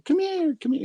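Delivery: high call across the yard; sing-song high call to the child. Retold or imitated. Mother calling her daughter back, high call. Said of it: "it was just calling her name".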